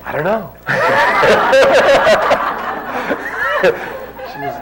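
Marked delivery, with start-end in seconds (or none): 0.0-0.5 laughing. Retold or imitated. Sheepish reply amid laughter. 0.6-3.6 high, breathy drawn-out laughter; long loud laugh. Real, not acted. Teller laughing hard at the memory, loud and long.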